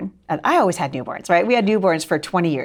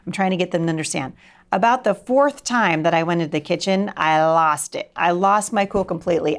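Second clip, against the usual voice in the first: ordinary talk, nothing marked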